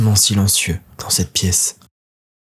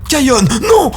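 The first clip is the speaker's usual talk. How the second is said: much higher, strained scream of a name; 14 semitones higher than usual. Acted. Father screaming at his son, panicked scream. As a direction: cry out in panic. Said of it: "Hurle son père".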